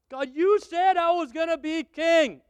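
complaining David. Acted.

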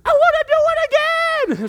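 very high, loud eager shout; thrilled kid. Retold or imitated. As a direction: beg to go again, bursting. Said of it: "I'm like".

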